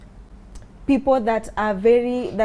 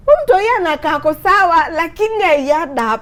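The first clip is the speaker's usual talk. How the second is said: raised, puzzled voice; imitating the riders; 5 semitones higher, 5 dB louder than usual. Riders puzzle over the calm girl, mildly raised imitation. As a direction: sound baffled by a fearless child.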